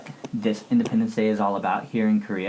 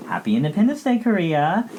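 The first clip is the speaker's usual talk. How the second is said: cheerful call; 8 semitones higher than usual. Real, not acted. Cheering Korean Independence Day.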